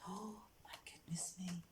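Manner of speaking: hushed, breathed astonishment; amazed whisper. Acted. Storyteller amazed to find the shoes already made, whispering. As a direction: whisper it in wonder.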